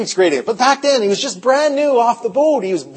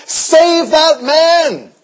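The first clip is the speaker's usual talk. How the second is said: slow, loud, drawn-out pleading cry to God; desperate church prayer, stretched and loud; 4 semitones higher, 3 dB louder, slower than usual. Retold or imitated. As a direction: beg God out loud, slowly, for a lost man. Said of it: "The church was pleading to God".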